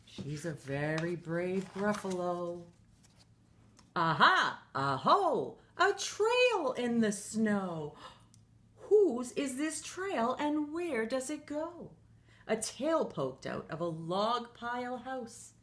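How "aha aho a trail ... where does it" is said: high, slow, thrilled "Aha!"; a little monster who just found a clue; excited discovery. Acted. Child finds a trail.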